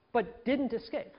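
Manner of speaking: usual speaking voice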